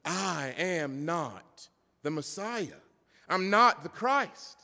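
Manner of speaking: slow, drawn-out "not", rising in force; emphatic denial. Acted. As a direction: spell out "I am not" word by word. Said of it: "That's emphasis if you don't know".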